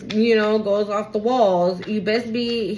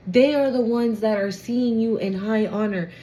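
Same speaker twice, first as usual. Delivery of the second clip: normal talking voice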